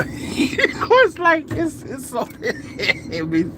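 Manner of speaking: high, wheezy laughter through the words; breaking up laughing. Real, not acted. Laughing at stupefied people, real giggling. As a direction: try to talk while cracking up.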